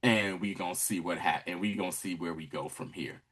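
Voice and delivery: low, knowing murmur; monitoring schemer. Retold or imitated. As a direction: keep it low, coolly biding your time. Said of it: "and is like".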